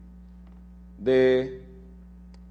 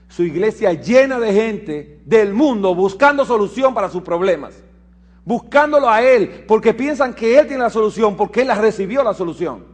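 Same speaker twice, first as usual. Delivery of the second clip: fervent preaching; 7 semitones higher, 8 dB louder than usual. Acted. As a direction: drive the message home. Predica con fuerza. (predicación fervorosa, alta y fuerte)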